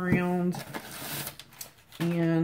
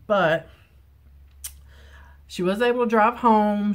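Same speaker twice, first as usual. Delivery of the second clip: normal talking voice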